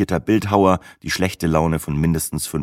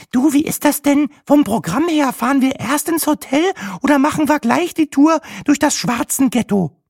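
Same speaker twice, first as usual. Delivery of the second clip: much higher, nervous female voice; nervous weather girl; 3 dB louder than usual. Acted. Nervous weather girl questioning the guide, much higher. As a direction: ask it high and jittery. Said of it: "nervös hüpfte der Wetterfloh".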